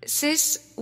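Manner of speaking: ordinary talk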